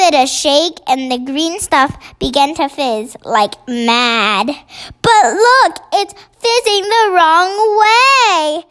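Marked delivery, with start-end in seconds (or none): 5.0-8.6 high, urgent exclamation; alarmed cry. A narrator's reading. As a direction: cry out to warn of something going wrong. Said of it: "cried Sophie".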